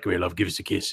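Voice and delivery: low, soft Cockney leer; annoying Cockney come-on; Cockney creep. Acted. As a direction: tease in a creepy, hushed Cockney. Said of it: "when I'm trying to annoy her".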